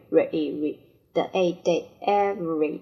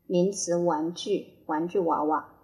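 normal talking voice

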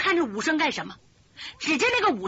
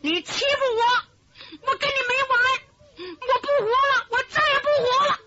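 put-on much higher, crying like a spoiled child; wailing sob; 11 semitones higher, 3 dB louder than usual. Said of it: "竟然哭了". (武生像耍赖的小孩一样哭喊)

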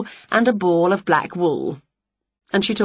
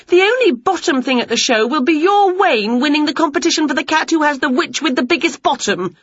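defiant retort; 8 semitones higher, 4 dB louder than usual. A narrator's reading. Winnie's defiant comeback.

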